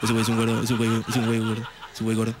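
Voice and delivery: a deep chorus of thoughts taunting; low mocking chant; mind voices. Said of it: "lo único que oirías todo el tiempo sería".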